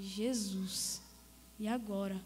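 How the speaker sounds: low, very soft dismay; desolada. Retold or imitated. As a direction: let it sink to a hushed, worried murmur. Her dismayed reply, retold. (voz baixa de desânimo)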